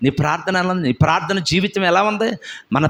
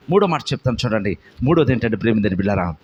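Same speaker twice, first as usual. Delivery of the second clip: usual speaking voice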